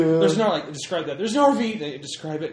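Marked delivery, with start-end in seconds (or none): 1.2-1.8 high panicked report; frantic caller. Acted. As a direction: shriek it like a scared caller. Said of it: "it says".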